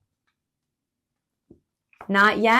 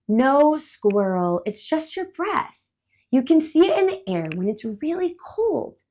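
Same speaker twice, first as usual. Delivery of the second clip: higher, louder explaining bird; patient bird; 5 semitones higher, 5 dB louder than usual. Acted. Patient explaining bird. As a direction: bird calmly explains cold breath to squirrel.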